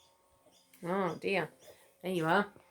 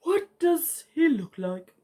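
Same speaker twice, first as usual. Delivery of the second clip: slow, drowsy and higher, like Pooh Bear; slow sleepy koala; 7 semitones higher, 3 dB louder than usual. A narrator's reading. Sleepy koala asking the emu about his chick, slowly. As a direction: let the words drag out sleepily. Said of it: "She asked slowly".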